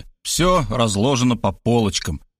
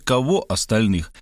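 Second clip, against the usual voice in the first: ordinary delivery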